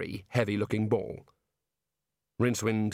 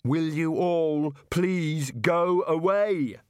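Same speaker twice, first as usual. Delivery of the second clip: slow, drawn-out shout, pitched up; 7 semitones higher, 4 dB louder, much slower than usual. A narrator's reading. Rincewind shouting at the warders to go away. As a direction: yell every word out at them, slowly. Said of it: "Rincewind shouted".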